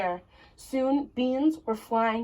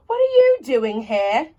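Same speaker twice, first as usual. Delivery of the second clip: startled cry, louder; cried out; 3 semitones higher, 7 dB louder than usual. Acted. Giant woman startled by Jack in her kitchen, loud cry. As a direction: call it out in surprise. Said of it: "she cried".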